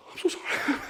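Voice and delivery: gasp, then high, voiceless apology; mortified mock apology. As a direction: imitate a panicked apology squeaked under the breath. Retold gasp and apology in wrong tent.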